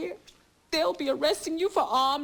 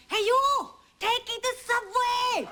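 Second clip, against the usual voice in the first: higher, louder cabbie shout; imitated cabbie yell; 6 semitones higher, 4 dB louder than usual. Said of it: "long enough to yell out".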